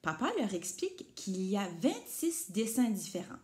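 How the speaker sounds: usual speaking voice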